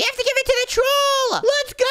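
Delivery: high-pitched rallying cry; over-excited high holler; excited yell. Pretend. Excited about quest.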